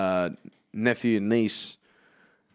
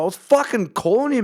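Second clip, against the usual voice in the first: high, loud annoyed shout; mock angry yell; 11 semitones higher, 4 dB louder than usual. Friend yelling at deaf mate, a loud annoyed shout. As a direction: call him out like he ignored you.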